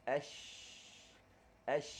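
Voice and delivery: "Ash" dengan angin mengalir; shin berangin. Shown as a demonstration. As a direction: desirkan shin. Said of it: "laluan angin masih berlaku". (shin dengan laluan angin)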